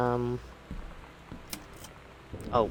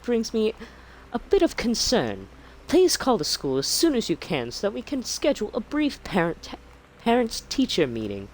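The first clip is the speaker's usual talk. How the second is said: high, swooping teacher voice; concerned schoolteacher; 10 semitones higher, 5 dB louder than usual. Acted. Teacher writing to a parent about her daughter's stories, in a high schoolteacher voice. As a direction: read it as a high-pitched, fussy schoolteacher. Reads teacher's letter in put-on voice.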